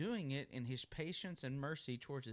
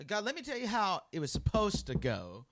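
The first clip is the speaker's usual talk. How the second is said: high, louder know-it-all lecturing God; mock a smug believer correcting God; 8 semitones higher, 5 dB louder than usual. Mocking know-it-all addressing God.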